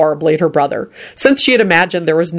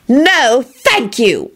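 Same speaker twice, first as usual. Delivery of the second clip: hissed; 7 semitones higher, much slower than usual. A narrator's reading. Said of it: "Elizabeth hissed".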